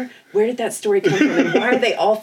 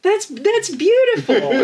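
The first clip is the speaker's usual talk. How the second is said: slow, higher, louder awe; drawn-out gushing; 12 semitones higher, 4 dB louder, much slower than usual. Real, not acted. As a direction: stretch out that's beautiful. S2 gushing over writing friendships.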